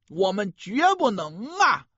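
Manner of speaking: ordinary delivery, nothing marked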